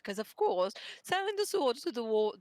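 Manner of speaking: usual speaking voice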